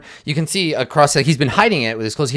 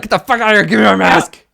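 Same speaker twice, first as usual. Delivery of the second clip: furious, higher yell; angry shout; 8 semitones higher, 5 dB louder than usual. Retold or imitated. Azaka yelling at the group for her mask, furious shout. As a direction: snap at them to hand back the mask. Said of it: "you just watched Azaka be like".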